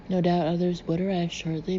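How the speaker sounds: plain reading voice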